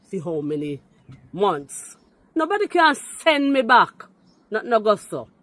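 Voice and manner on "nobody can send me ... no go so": loud, high-pitched defiance; defiant outburst. Real, not acted. Woman insisting on her right to stay, loudly defiant. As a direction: raise your voice in real indignation. Defiant rebuttal in heated rant.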